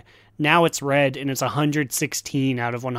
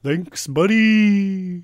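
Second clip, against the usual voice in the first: offhand god voice; 4 semitones higher, much slower than usual. Pretend. Imitating a game god leaving.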